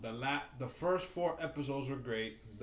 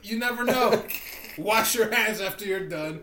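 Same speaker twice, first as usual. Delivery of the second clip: louder, laughing advice; 6 semitones higher, 6 dB louder than usual. Real, not acted. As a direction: laugh through the tips.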